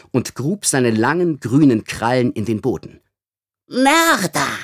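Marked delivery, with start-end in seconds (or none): 3.7-4.6 much higher, hissed accusation; hissing amplified voice. Acted. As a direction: hiss it through an amplifier. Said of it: "zischte er sie mit künstlich verstärkter Stimme an".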